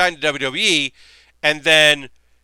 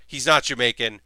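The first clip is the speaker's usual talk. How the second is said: normal talking voice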